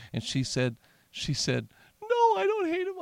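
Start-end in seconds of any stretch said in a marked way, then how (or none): 2.0-3.0 high, thin imitation of the wife insisting; wife's voice. Counselor retelling, mimicking the wife's high protest. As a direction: pitch way up to play the wife. Husband voicing crying wife's reply.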